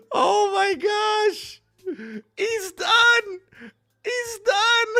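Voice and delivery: high, slow amazed shout; excited shouting. Real, not acted. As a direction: shout it in disbelief. Celebrating the finish.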